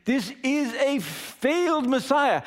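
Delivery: high, slow, emphatic declaration; scornful verdict. Acted. Crowd judging Jesus on the cross, scornful. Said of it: "basically saying over and over again".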